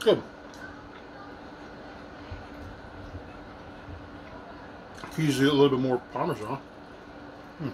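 chewing between words; talking while eating. Real, not acted. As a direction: food near mouth. Seen 0: Holds pizza slice while talking, then raises it and bites.

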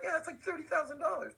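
high mock disbelief; incredulous. Owner reacting to editing costs, high disbelief. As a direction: squeak out the price in disbelief.

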